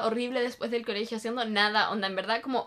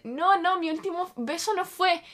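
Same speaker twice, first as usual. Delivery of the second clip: imitated insistent denial, pitched higher; astonished denial, retold; 6 semitones higher than usual. Friend correcting her about the last kiss, astonished. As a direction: contradict with raised, emphatic surprise.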